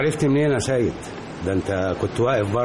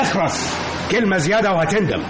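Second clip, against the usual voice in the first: angry threat; 7 semitones higher than usual. Acted. Lawyer silencing the grieving father, angry. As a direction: cut him off with a threat.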